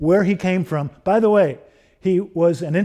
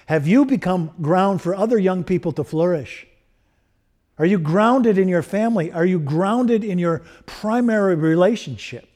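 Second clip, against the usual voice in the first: higher, urgent run of pulpit questions; exhorting preacher; 5 semitones higher than usual. Real, not acted. Preacher challenges congregation about where they are anchored, exhorting. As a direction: press each question on the listeners.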